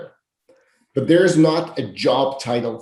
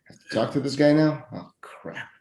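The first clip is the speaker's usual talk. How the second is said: hushed, grumbling inner dread; mock-reluctant muttering, dropping into a whisper; begrudging; 3 dB quieter than usual. Acting out an interviewer told to talk to this guy, begrudging.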